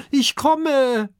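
high, loud call from afar. A narrator's reading. Oskar runs over the dunes calling the others to wait, loud. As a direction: shout it while running up. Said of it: "ruft er".

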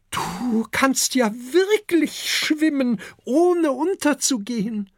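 high, slow, marveling; amazed. A narrator's reading. Mother Adele marvels that her son can swim, high and slow. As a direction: wonder at it aloud. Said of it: "staunt Adele".

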